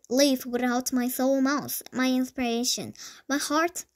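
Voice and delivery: ordinary delivery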